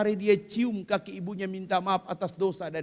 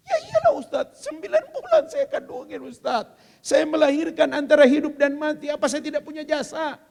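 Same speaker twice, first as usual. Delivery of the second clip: much higher, laughing protest of a put-out mother; indignant mother; 7 semitones higher, 5 dB louder than usual. Acted. Preacher voicing a mother who insists she earned credit, indignant. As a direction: squeal it like an offended mom.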